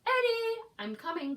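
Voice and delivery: high, loud calling. Acted. Mum calling to Eddie, loud and high. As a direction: call out from afar. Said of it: "called Mum".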